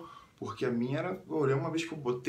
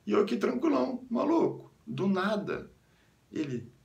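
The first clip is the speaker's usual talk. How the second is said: ordinary delivery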